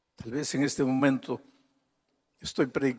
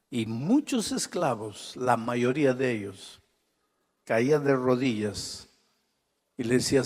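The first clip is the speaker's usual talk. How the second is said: ordinary talk, nothing marked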